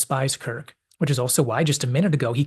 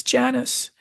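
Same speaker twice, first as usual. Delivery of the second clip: high, yearning call of her name; high plaintive call to Janice; pleading call; 11 semitones higher than usual. Retold or imitated. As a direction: reach out to her with your voice. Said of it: "and he says".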